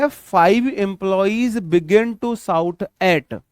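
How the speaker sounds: slow dictation read. Shown as a demonstration. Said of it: "teacher reads the example slowly".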